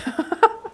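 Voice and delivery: short amused chuckle; real laugh at a cute memory; light laugh. Real, not acted. Laughing at the granddaughter story.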